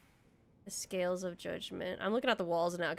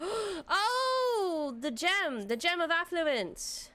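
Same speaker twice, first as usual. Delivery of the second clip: awed gasp; 8 semitones higher, much slower than usual. Real, not acted. Spots the treasure gem.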